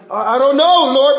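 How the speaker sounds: loud, high, flustered stammer; panicking Philip. Acted. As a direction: act out a hammed-up panic. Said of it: "we know Philip is panicking".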